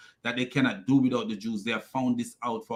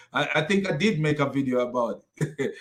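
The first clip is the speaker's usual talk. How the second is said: normal talking voice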